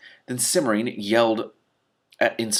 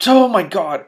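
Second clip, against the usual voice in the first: high, loud cry of alarm; shocked exclamation; 9 semitones higher, 9 dB louder, slower than usual. Real, not acted. Reader crying out as the spear hits the donkey. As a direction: blurt it out in shock.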